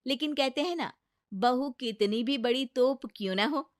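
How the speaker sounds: usual speaking voice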